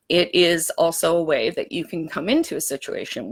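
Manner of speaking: normal talking voice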